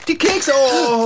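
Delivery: high dismayed cry; shocked. Acted. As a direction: gasp out in sudden dismay. Sat on the cookies.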